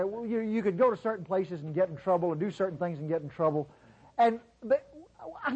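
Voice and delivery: ordinary delivery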